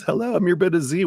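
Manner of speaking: high, quick catchphrase hello; sing-song intro. Real, not acted. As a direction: chirp the channel intro.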